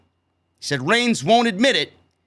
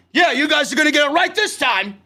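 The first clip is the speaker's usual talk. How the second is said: 1 angry retort; 5 semitones higher than usual. Pretend. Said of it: "I'd look at them and say".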